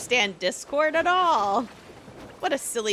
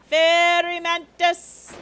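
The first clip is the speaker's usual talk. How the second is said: drawn-out call; 3 semitones higher, slower than usual. Real, not acted. Calling out a bug spawn, drawn out.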